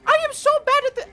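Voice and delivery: very high, fast fake British accent; squeaky put-on British accent; mock British accent. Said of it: "how do you do a British accent?".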